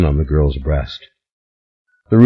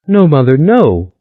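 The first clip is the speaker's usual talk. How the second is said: high, louder pleading wail; desperate crying plea; weeping; 7 semitones higher, 4 dB louder than usual. A narrator's reading. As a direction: beg through sobs, pitched up. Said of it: "The tall, thin girl wept".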